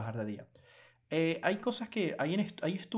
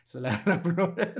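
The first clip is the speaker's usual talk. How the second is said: laughing; 4 semitones higher, 6 dB louder than usual. Real, not acted. Joking award ceremony image.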